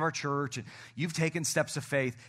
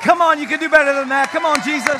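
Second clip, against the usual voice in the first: hype shout; 12 semitones higher, 13 dB louder than usual. Real, not acted. Pastor pumping up the crowd.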